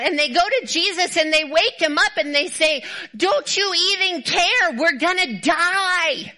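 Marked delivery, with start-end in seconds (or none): 3.1-6.3 high, drawn-out wail of mock panic; hammed-up desperate plea, stretching the last word; dramatic panic. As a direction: act out the disciples' overblown alarm. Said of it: "That's a lot of drama".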